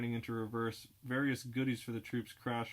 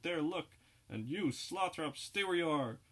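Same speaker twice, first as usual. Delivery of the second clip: raised mock holler, higher pitch; hollering civilian; 6 semitones higher, 4 dB louder than usual. Civilians chasing down the pier, shouting a raised mock holler. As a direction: call out after the fleeing man.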